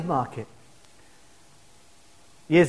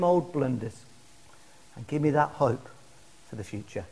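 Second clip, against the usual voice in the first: lower, hushed plea to God; humble prayer; 3 semitones lower, 3 dB quieter than usual. Shown as a demonstration. Preacher modelling a repentant prayer to God, humble. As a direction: pray it quietly, softening towards the end. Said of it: "Come humbly".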